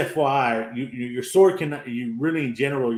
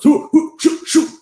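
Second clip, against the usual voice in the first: squeaky high hoo hoo and shoot, much louder; high mock battle cry; 12 dB louder than usual. Retelling the boy swinging at a moth, high loud yelps. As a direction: yelp it out high and loud like a cartoon swordsman. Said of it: "He goes".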